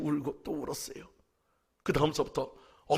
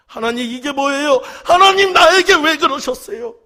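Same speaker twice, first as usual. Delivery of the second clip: anguished cry; 11 semitones higher, 17 dB louder than usual. Retold or imitated. Pastor in church crying out at God, a loud anguished wail.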